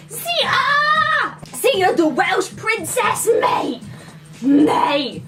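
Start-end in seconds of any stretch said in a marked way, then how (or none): 0.1-3.3 much higher shout of Zeta; calling out. Acted. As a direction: call out to her adoringly.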